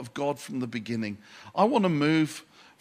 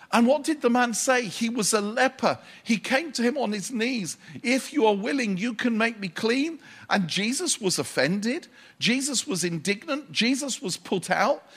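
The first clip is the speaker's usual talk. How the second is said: impassioned preaching; 6 semitones higher than usual. Acted. Preaching at full force.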